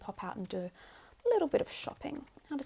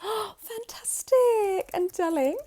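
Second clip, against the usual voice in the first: high, loud, drawn-out delight; thrilled; 10 semitones higher, 9 dB louder, slower than usual. Acted. Mother hears the play is Wizard of Oz, thrilled. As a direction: gasp and gush with excitement.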